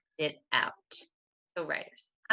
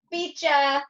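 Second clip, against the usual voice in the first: taunting; 9 semitones higher, 4 dB louder than usual. Retold or imitated. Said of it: "I said to my sister as I sprinted".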